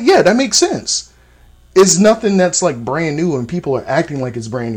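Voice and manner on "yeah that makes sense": high-pitched reenacted agreement; easy agreement. Recalling his reaction to what he heard, high-pitched agreement. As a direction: agree as if it is obvious, pitched well up.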